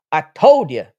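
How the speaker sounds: loud, emphatic gloat. Real, not acted. Streamer to doubting viewers after Liverpool score, gloating. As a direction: punch it out loudly, proved right.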